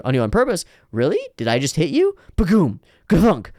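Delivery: ordinary talk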